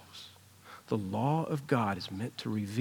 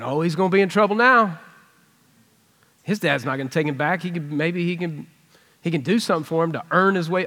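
worried outburst; 4 semitones higher, 7 dB louder, faster than usual. Retold or imitated. Larry anxious interruption voiced.

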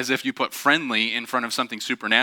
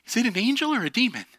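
hint of an anxious kid; 10 semitones higher, faster than usual. Child asks parent helper.

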